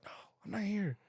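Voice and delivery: hushed mock irritation; annoyed dad. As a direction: imitate dad secretly refusing a call. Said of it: "shake his head all pissed off".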